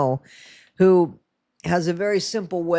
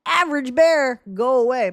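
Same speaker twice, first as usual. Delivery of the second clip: put-on higher, louder Bayou-accent shoo; hammed-up Bayou accent, higher; 7 semitones higher, 4 dB louder than usual. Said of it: "It was supposed to be that Bayou accent".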